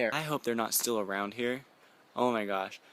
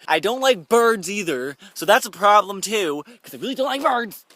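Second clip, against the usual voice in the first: much higher, louder, slowed; freaked out; 9 semitones higher, 12 dB louder than usual. Real, not acted. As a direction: squeal in genuine fright. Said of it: "And I'm freaked out".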